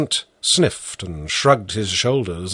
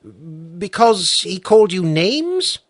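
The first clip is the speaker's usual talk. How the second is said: higher, slow, probing question; sharp judge; 8 semitones higher than usual. A narrator's reading. Judge cuts in to question the peasant, slow and probing. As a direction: pin down whose clover it was.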